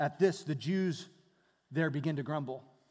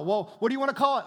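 fast, louder, higher asking; eager question; 6 semitones higher, 8 dB louder, much faster than usual. Acted. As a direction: rattle it off eagerly. Israelites puzzling over manna.

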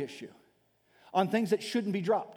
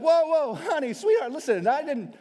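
placating spouse; 6 semitones higher, 5 dB louder than usual. Retold or imitated.

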